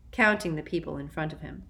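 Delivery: plain reading voice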